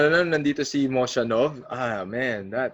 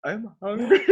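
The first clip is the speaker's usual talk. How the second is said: high whiny laughing plea; 8 semitones higher than usual. Retold or imitated. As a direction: whine like a starving kid. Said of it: "So we're like".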